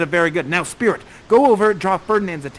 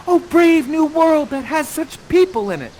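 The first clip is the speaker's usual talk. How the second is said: put-on much higher, louder, awed girl's voice; wonderstruck Miranda; 9 semitones higher, 7 dB louder than usual. Host voicing Miranda's famous line in awe. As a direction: gasp it out in wonder. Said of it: "you have this beautiful line".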